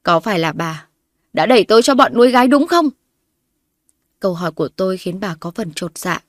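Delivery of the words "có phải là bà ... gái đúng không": rising, louder accusing demand; furious question. A narrator's reading. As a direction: confront her with red eyes. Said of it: "bằng đôi mắt đỏ ngầu mà hỏi".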